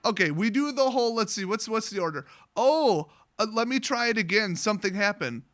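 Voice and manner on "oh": normal talking voice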